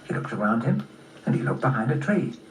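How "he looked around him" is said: ordinary delivery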